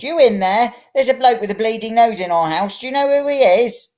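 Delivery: put-on high, loud old lady calling out; calling old woman. A narrator's reading. Old woman calls to her husband about the intruder, high and loud. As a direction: holler into the next room.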